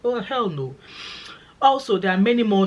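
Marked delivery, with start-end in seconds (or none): 0.0-0.8 higher, sassy "hell no"; sassy refusal. Real, not acted. Vlogger asked to leave Scotland, sassy and high. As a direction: toss off the refusal with attitude.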